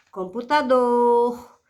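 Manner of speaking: "computador" slowly, with a long strong "dor"; stress on final syllable. Shown as a demonstration. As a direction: draw out the end of "computador". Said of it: "sílaba pronunciada com mais força". ("computador" devagar, com "dor" forte e alongado)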